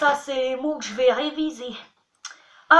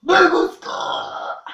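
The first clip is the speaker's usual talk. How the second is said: "Me gusta" belched slowly, higher and louder; slow high burp; 6 semitones higher, 5 dB louder, much slower than usual. Shown as a demonstration. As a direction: stretch "Me gusta" over a long burp. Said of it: "en rotant".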